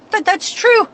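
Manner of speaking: high, sarcastic mimicry of her; mock her words in a high squeaky voice. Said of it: "And I'm like".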